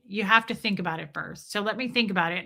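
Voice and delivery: ordinary delivery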